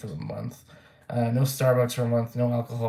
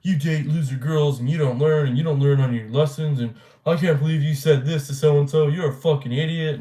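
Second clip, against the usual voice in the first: a hint of a sneering friend, a bit louder; mock berating friend; 3 semitones higher, 5 dB louder than usual. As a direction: imitate his condescending lecture, slightly raised. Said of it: "being like".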